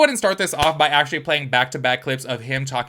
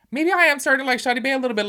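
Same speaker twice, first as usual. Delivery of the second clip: high, fast and giddy; excited; 11 semitones higher, faster than usual. Real, not acted. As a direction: gush it out, voice way up and quick. Excited admission about Shadi Bae.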